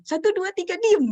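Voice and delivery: high, loud mock countdown; mother's warning count. Mother counting at crying child, loud mock warning. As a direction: count like a fed-up mom issuing a threat. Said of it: "ibu hitung nih, sampai satu, tiga".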